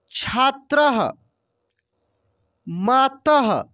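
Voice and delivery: अतः said slowly with a clear breathy "ah" released after the last consonant; teacher drill, stretch the final ः into an audible "aha"; breathy visarga ending. Shown as a demonstration. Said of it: "अह का उच्चारण हो रहा है".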